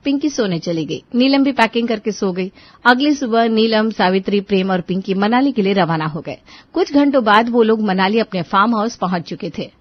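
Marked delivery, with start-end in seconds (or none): none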